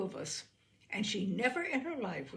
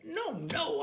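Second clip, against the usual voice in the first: irritable; 7 semitones higher than usual. A narrator's reading. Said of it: "I told him irritably".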